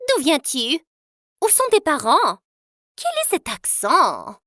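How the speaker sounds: suspicious grilling. Acted. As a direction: press her like a suspicious rival. Diane interrogates her suspiciously.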